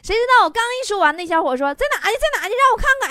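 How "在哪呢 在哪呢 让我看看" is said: high, urgent excited questions; eager demand. Acted. Man who lost money asking to see it, high and eager. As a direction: jump in high, desperate to see it.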